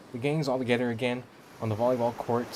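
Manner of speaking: ordinary talk, nothing marked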